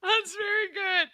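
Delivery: high, breaking laughter through the words; laughing. Real, not acted. Host cracking up at a co-host's pun, laughing. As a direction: crack up while praising.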